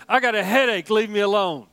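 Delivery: a hint of a whiny three-year-old; mock the toddler's grumbling; grumpy toddler. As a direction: grandfather imitating his grandson's complaint, lightly whiny. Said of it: "He said".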